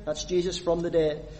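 normal talking voice

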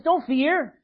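angel reassuring. Retold or imitated.

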